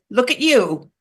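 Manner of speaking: a hungry eagle refusing orders; angry eagle. Acted. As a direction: bark down at her in proud anger. Eagle snarls back at beaver.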